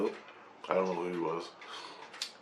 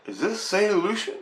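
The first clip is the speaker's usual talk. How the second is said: much higher-pitched disbelief; acted amazed question; 10 semitones higher than usual. Dreamer asks where he is, high amazed question. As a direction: retell it in a high, wondering voice. Acts out his bewildered question.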